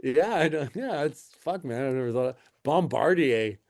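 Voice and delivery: ordinary delivery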